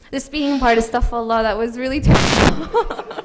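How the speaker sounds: laughing. Real, not acted. Laughing confession about speeding.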